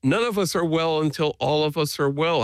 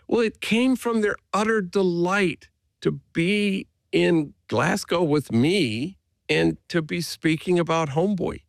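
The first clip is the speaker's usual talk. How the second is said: ordinary talk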